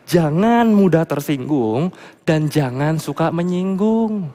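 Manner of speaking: ordinary delivery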